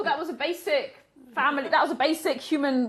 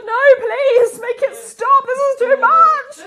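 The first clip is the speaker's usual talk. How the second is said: higher, louder, half-crying plea; mock despair; 11 semitones higher, 11 dB louder, slower than usual. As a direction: beg for it to stop, hammed-up. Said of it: "I was like".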